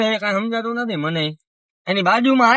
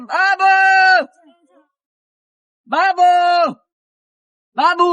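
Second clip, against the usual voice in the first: loud high call across the street; 7 semitones higher, 5 dB louder, slower than usual. Real, not acted. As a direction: shout his name to fetch him. Calling out to Babu's house.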